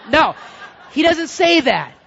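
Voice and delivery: loud, high correction; emphatic denial. Real, not acted. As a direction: shut the idea down forcefully. Correcting his own joke emphatically.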